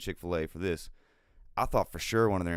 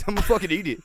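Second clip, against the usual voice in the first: laughing quote; 11 semitones higher, 8 dB louder, much faster than usual. Retold or imitated. Quoting friend, laughing.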